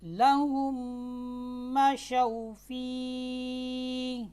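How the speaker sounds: slow chanted tajweed recitation; Quran recitation. Acted. Teacher recites the verse for students, slow and chanted. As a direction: intone the verse slowly, melodically.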